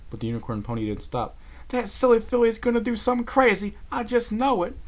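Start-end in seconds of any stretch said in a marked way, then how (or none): 1.7-4.8 high country fret; exasperated worry; Applejack worried. A narrator's reading.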